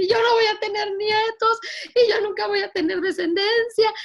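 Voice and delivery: high, loud crying protest; tearful lament. Retold or imitated. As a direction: cry it out, pleading and high. Lamenting to her mother, crying.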